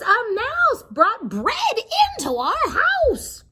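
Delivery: high, loud slow scream of the cobbler and wife. A narrator's reading. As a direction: shriek it, drawing the words out.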